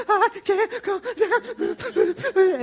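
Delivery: high, loud, gasping mock sobs; hammed-up child crying too hard to talk; worked-up sobbing. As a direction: stutter the words out between fake sobs, very slowly. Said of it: "would get so worked up".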